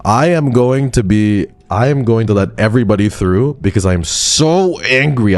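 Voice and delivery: theatrical pretend rage; mock angry declaration. Real, not acted. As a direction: ham up the threat of letting everybody through.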